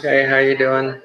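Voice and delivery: high, held-pitch put-on hello; mimicked greeting. Traveler greeting the new team, high mock politeness.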